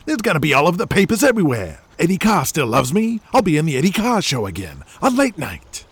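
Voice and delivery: fast, high, giddy; excited rush. Acted. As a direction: rattle it off, thrilled. Jimmy Buck, fast and excited.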